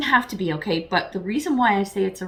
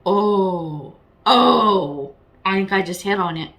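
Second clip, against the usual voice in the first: quick, eager burst of discovery; excited realization; 5 dB louder, slower than usual. Real, not acted. Tarot reader spotting the pattern for Virgo, excited. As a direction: blurt it out as it clicks.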